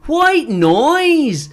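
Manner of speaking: loud high mock shout of the phrase. Retelling a guy's outburst, loud mocking shout. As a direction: belt it out like the guy did.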